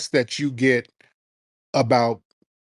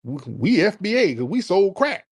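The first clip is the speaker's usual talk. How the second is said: higher, incredulous mimicry; mocking quote; 6 semitones higher than usual. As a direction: parrot their boast back mockingly. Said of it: "the hell does that mean".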